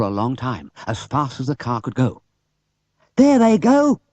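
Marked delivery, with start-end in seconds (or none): 3.2-4.0 much higher, loud shout; Bill spotting the thieves; excited cry. Acted. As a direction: call out spotting them ahead. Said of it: "cried Bill suddenly".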